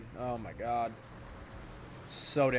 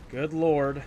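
ordinary delivery

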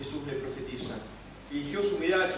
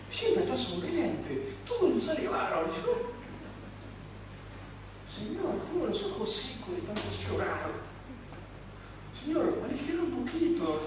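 higher, pleading voice with a weepy edge; whiny Peter; 8 semitones higher than usual. Pretend. Peter complaining to the Lord about John, high whiny plea. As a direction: complain to the Lord like a sulking disciple.

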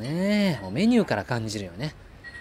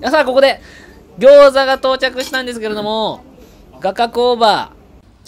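much higher and louder hype; punchy variety show voiceover; 12 semitones higher, 13 dB louder than usual. Acted. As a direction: announce like a TV host. Sudden high loud host announcement.

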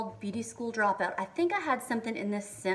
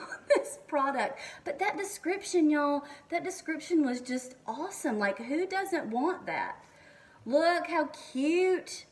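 excited gushing; 5 semitones higher, 3 dB louder than usual. Real, not acted. Gushing over cute applicator.

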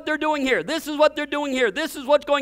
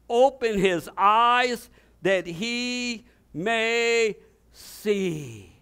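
slow prayer; much slower than usual. Acted. Elijah's prayer acted.

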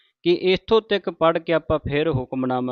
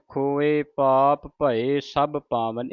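slow, with a full stop after ਪਾਵਨ; ਪਾਵਨ ਤੇ ਵਿਸਰਾਮ; pause after ਪਾਵਨ; much slower than usual. Shown as a demonstration. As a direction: recite slowly and rest on ਪਾਵਨ. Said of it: "ਇੱਥੇ ਵਿਸਰਾਮ ਲਾਉਣਾ".

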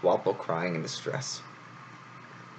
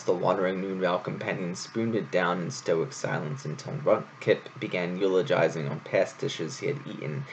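ordinary delivery

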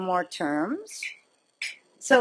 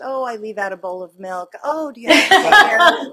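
laughing pat answer; 2 semitones higher, 10 dB louder than usual. Retold or imitated. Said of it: "I have these pat answers".

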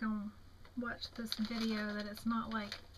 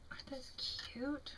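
whisper; 2 semitones higher, 7 dB quieter than usual. Real, not acted. Admiring cards to camera.

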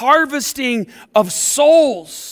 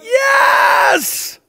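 much higher and louder cheering shout; jubilant shouting crowd; 10 semitones higher, 7 dB louder, much slower than usual. Acted. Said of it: "all God's people are like".

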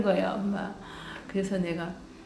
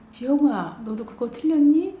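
higher and much louder, amazed question; surprised exclamation; 6 semitones higher, 10 dB louder than usual. Retold or imitated. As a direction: blurt it out in disbelief. Friend's mom surprised by answer.

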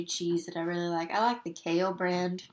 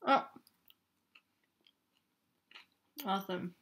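eating burrito while speaking; talking while chewing; 4 dB quieter than usual. Real, not acted. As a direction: mouth partly full of food. Seen 1: bites at -5 to -2, lips closed chewing early, then clearer speech.

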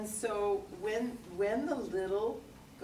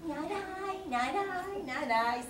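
much higher little mouse squeak; squeaky mouse voice; 11 semitones higher, slower than usual. Acted. Teacher voices the mouse refusing, up high. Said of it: "said the".

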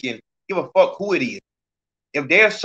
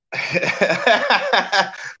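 burst of laughter; 4 semitones higher than usual. Real, not acted. Host breaks up mid-sentence.

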